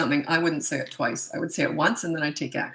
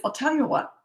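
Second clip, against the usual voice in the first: breezy like a business deal, no anger; calm disciplining voice; 9 semitones higher than usual. Shown as a demonstration. Mom to a kid refusing dishes, calm breezy discipline. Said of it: "if I'd said to my kids".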